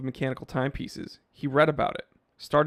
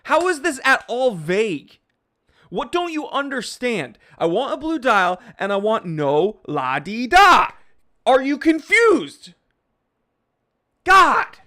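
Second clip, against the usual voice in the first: loud, much higher hammed-up yelling; mock shouting; 8 semitones higher, 6 dB louder than usual. Vlogger shouting at confused viewers, hammed-up and loud. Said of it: "I can't even hear myself".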